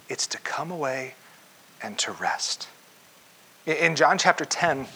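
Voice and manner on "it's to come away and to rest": much softer, tender close; softly offering rest; gentle invitation. Acted. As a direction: let it settle quietly.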